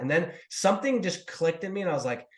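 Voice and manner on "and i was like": ordinary talk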